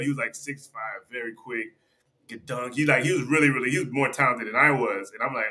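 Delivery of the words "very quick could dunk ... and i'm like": normal talking voice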